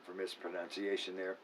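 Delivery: usual speaking voice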